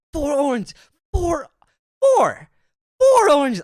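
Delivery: hammed-up indignation, building to a yell; mock outrage. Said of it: "I've never been more upset".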